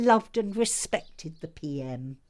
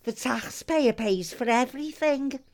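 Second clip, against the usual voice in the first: usual speaking voice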